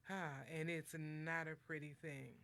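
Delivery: ordinary talk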